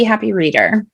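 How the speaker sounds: ordinary delivery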